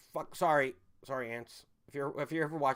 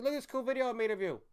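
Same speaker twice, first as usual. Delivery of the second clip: high, quick, singsong imagined quote; mock cheerful showing-off; 10 semitones higher, faster than usual. Streamer imagining showing relatives his video years later, mock cheerful. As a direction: pipe up in a high, eager voice.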